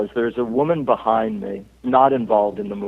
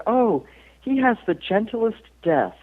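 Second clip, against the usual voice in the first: lightly voiced, higher, slow fan gushing; imitating the ticket taker; 9 semitones higher than usual. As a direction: play the ticket taker in gentle awe, slowly. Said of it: "this was the guy's reaction".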